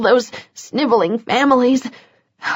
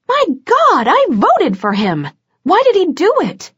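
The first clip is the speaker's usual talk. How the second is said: shocked outburst; 9 semitones higher, 3 dB louder than usual. Acted. Realizing she voted for him.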